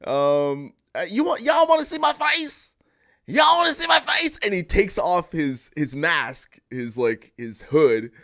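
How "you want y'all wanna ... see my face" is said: high, loud Southern holler; Dave Chappelle. Retold or imitated. Masked speaker answering a heckler at rally, mock Southern shout. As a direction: shout back as a hammy Klan speaker. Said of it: "and Dave Chappelle's like".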